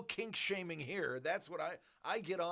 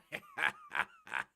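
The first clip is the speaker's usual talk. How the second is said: squealing laugh up high; real high squeal of laughter; 3 dB louder than usual. Real, not acted. Laughing at own joke.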